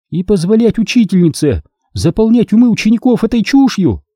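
high, incredulous protest; stunned question, pitched well up; astonished. A narrator's reading. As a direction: ask it in outraged disbelief. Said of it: "поражённо спросила Сири".

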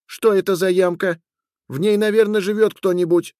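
high, insistent questioning; pestering wife. A narrator's reading. Wife pestering her husband about the pit, high and insistent. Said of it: "продолжала приставать к мужу Катри".

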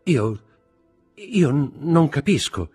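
high, halting, broken-off words; faltering protest. A narrator's reading. Accused wife to her husband, faltering.

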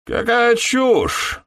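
very high, slow, shrieked dismissal; squealing outburst. Acted. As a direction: voice cracks up into a squeal. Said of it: "Его голос почти сорвался на визг".

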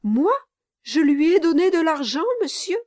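high, loud, quick incredulous question; indignant disbelief. A narrator's reading. Brass denies to Garland that he paid Kit, indignant disbelief. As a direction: throw the accusation back, astonished.

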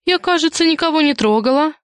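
put-on high girlish voice of innocence; accused girl playing innocent, high-pitched; squeaky protest. A narrator's reading.

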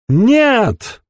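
high, clear, defiant cry. Acted. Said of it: "чистый высокий голос".

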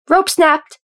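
high, loud cry of warning; sudden shout. A narrator's reading. Someone warns the work crew, shouting suddenly. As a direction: shout the alarm, pitch up. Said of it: "someone suddenly shouted".

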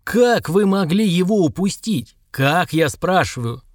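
slow, drawn-out, outraged demand; furious boss. A narrator's reading. Boss furious that his staff lost their man, raging demand. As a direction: grill them in stretched-out, angry words. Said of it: "яростно ударил кулаком по столу".

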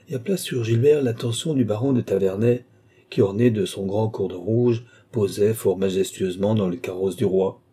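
ordinary delivery, nothing marked

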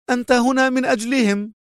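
hateful charge; angry loathing. A narrator's reading. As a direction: spit out the accusation. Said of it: "في مقت غاضب".

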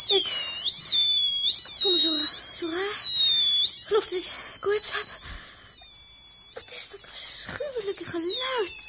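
high, dazed feverish voice; feverish daze. Acted. Els tells the crew she feels feverish, high and dazed. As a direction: sound lightheaded and sick.

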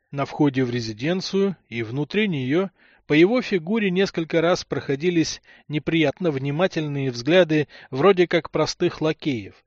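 plain reading voice

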